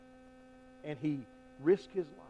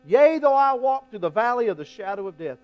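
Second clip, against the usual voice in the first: the verse called out louder and higher; proclaimed verse; 5 semitones higher, 12 dB louder than usual. Shown as a demonstration. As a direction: ring it out like a proclamation. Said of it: "repeated verse".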